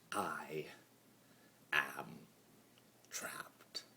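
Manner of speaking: soft breathy whisper; whispered confession. A narrator's reading. Chaos spirit admitting to himself he is trapped, whispered. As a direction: let the word fall out as a secret.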